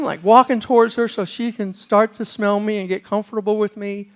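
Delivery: ordinary talk, nothing marked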